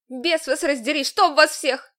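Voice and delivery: loud furious outburst; shouting. A narrator's reading. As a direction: yell it while fighting them off. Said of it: "закричала я".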